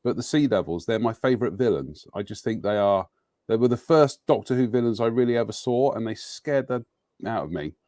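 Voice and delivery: ordinary talk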